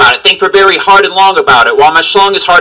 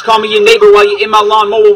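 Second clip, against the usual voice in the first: ordinary talk, nothing marked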